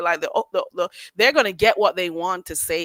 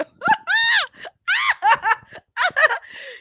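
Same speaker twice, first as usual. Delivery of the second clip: shrieking laugh. Real, not acted. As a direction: howl with laughter. Loud laugh.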